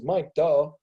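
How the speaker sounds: mocking duh. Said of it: "he just looks at me and says".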